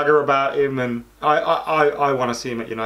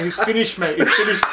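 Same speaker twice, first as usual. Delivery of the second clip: higher, laughing dismissive host; radio host cutting the caller off, higher laughing voice; mocking radio host; 6 semitones higher than usual. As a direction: brush him off, laughing. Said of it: "And the geezer's going".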